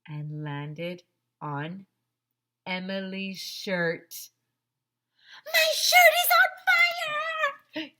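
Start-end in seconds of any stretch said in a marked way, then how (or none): 5.5-7.9 high, loud, slow scream of panic; very high terrified child scream; panicked scream. A narrator's reading. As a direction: shriek like your shirt is burning. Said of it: "screamed Emily".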